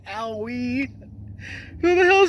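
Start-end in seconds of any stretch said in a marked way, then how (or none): 0.1-0.9 high, softer imitation of a yelp; mock scream. Host repeating the clip's cry for laughs, mock screaming. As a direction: mimic his scream in a small, raised voice.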